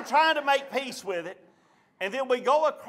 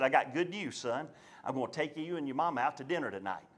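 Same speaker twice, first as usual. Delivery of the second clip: low dad voice; 9 semitones lower, 10 dB quieter than usual. Retold or imitated.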